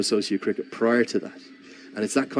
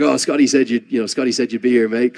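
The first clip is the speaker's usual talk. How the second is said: higher and louder cheery Aussie hello; hearty mock greeting; 4 semitones higher, 9 dB louder than usual. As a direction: boom out a friendly welcome, imitating a big sporting star. Brett Lee greets him in bar.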